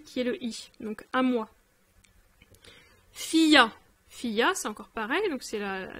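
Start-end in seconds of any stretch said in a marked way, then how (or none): 3.1-3.7 fia said clearly, louder; model word. Shown as a demonstration. Teacher modelling fia to a student, clear. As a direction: sound out fia. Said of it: "la préposition fi".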